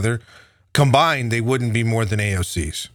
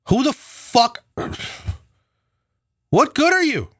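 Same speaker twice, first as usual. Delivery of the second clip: much higher, yelling in disgust; furious rant; 9 semitones higher than usual. Real, not acted. Ranting at the party establishment, high furious yell. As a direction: tear into them, voice climbing high.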